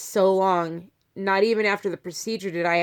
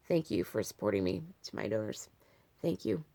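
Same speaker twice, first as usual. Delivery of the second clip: soft sincere thanks; 9 semitones lower, 10 dB quieter than usual. Real, not acted. Thanking donors at the end.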